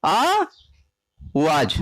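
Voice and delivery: आवाज stretched very slowly, low pitch; drawn-out correct word. Shown as a demonstration. Students ko आवाज dheere, lamba kheenchkar bolna. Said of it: "क्या होगा".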